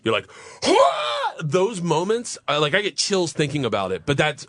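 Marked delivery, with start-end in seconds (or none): 0.7-1.3 very high, open-throated yell; re-enacted whoop of adrenaline; exhilarated scream. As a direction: let out a thrill-ride scream. Re-enacts the thrill of charging downhill.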